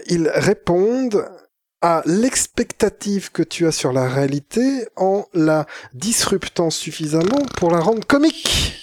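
slow, pondering mock intellectual; laboured philosophical delivery; TV philosopher. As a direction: stretch the words like a pompous thinker. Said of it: "je fais mon philosophe de Tadei".

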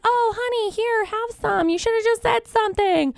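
high, soothing impression of a consoling woman; sympathetic cooing. Office lady comforting a sobbing student, sympathetic cooing. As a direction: coo it in a high, pitying voice.

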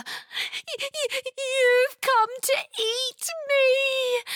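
high stuttered wail, drawn out; stammer, then wail in terror; very high, slow, frightened cry; stammering horror. A narrator's reading. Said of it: "closing her eyes in horror".

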